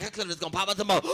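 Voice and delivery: usual speaking voice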